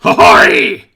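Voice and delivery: high, loud, proud goblin voice; goblin captain. Acted. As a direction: bellow it like a pompous little goblin captain.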